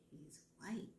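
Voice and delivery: breathy secret whisper under the breath; whispered aside. Acted. Georgia whispers a private thought about Bruce, hushed. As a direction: let it slip out as a hushed aside.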